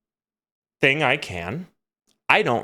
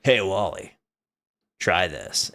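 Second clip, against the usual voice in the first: lower, softer big brother coaxing; low voice as older brother; 8 semitones lower, 5 dB quieter than usual. Retold or imitated. Older brother offering salvia to Wally, low and coaxing. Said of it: "And he's like".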